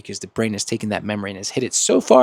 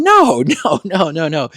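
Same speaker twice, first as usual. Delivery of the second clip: laughing no; 6 semitones higher, 8 dB louder than usual. Real, not acted. Rejecting freaking back.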